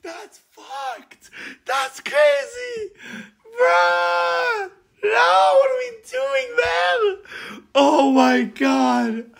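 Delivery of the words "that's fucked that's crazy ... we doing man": laughing shouts. Real, not acted. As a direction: crack up in disbelief at the absurd scene. Reacting to absurd scene.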